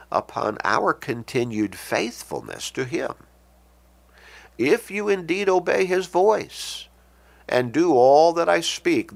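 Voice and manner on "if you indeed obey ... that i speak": "If" launched high, the verse declaimed after it; raised, deliberate reading led by "If"; stress on IF. Real, not acted. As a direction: start high on "If" and keep the condition emphatic. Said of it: "I emphasize that word".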